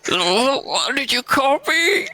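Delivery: high, indignant squeak of disbelief; a wounded little dragon, outraged; offended gasp. Acted. Game master's crying dragon demanding an answer from the giant, high and indignant.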